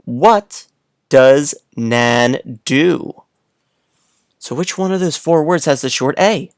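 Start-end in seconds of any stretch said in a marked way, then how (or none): 0.0-3.2 put-on slow, word by word read to young kids; slow reading aloud. Shown as a demonstration. Teacher reading a sentence slowly to little kids. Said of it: "Let me read it one more time".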